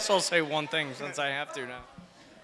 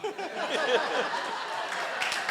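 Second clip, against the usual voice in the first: high breathy giggle; 12 semitones higher than usual. Real, not acted. Laughing after toast joke.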